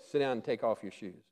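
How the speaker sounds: understated reply to an eager servant; hushed reply. Acted. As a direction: answer quietly and simply. God's quiet answer.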